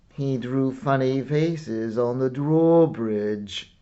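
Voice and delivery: plain reading voice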